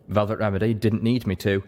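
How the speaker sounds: ordinary talk, nothing marked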